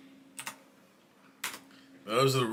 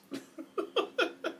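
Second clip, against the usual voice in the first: high laugh. Real, not acted. Laughing at the Florida joke.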